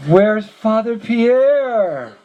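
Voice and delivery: high, very drawn-out question; insistent questioning. Retold or imitated. Visitor asking the monk after his old friend, drawn-out questioning. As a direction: stretch it out slowly, pitched well up. Acts out demanding after the priest.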